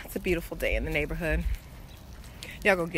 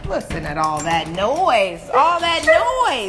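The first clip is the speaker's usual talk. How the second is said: loud call; 3 semitones higher, 8 dB louder, slower than usual. Real, not acted. Dogs barking, calling attention.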